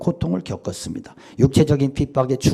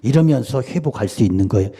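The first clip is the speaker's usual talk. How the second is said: ordinary talk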